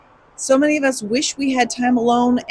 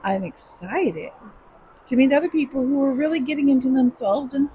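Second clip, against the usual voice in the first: ordinary delivery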